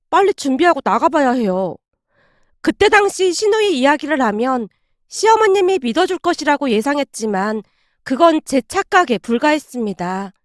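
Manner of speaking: ordinary talk, nothing marked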